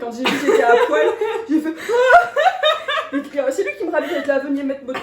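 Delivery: rushing the words out between laughs; laughing. Real, not acted. Laughing as she tells about the doctor dressing her.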